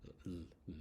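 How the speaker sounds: soft held "L", tongue on the roof of the mouth; "L" as a hummed consonant, three separate times; isolated L sound. Shown as a demonstration. Teacher showing where the tongue goes, isolated L sound. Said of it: "tongue on the roof of your mouth".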